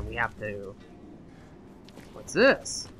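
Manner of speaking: ordinary talk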